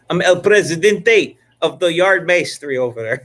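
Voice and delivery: grand mock-Spanish announcer; mock Spanish accent. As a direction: proclaim it in a playful fake Spanish accent. Jokingly grand title for himself.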